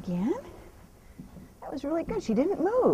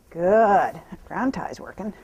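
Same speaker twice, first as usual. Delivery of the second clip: ordinary talk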